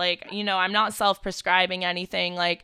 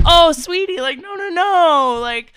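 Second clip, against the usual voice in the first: high, louder fond reassurance; mock reassurance; 6 semitones higher, 7 dB louder, slower than usual. Telling the worried date not to fret, loud high mock comfort. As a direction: coo at him like soothing a child. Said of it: "And I was like".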